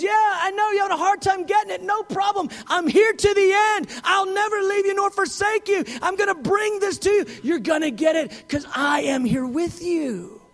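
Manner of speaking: high, loud cheering reassurance; enthusiastic encouragement. Retold or imitated. Preacher voicing God loudly encouraging a struggling believer. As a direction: cheer them on like a devoted coach. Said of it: "He goes".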